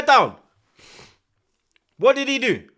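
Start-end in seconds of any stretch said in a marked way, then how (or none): none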